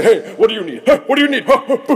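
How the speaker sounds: high, excited magical helper; playful, high-pitched hammy wish-granter; Genie from Aladdin. Acted. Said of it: "kinda like the genie in Aladdin".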